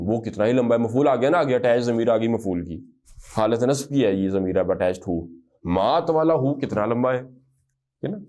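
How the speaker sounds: usual speaking voice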